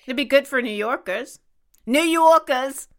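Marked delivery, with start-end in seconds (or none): 1.8-2.9 loud, high mock New York accent; hammed-up New Yorker, R dropped. Playful New York accent repeat.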